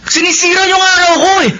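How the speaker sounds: fed-up son cutting off his mother; irritated son. Acted. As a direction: snap back at a nagging mother. Son snaps at pleading mother.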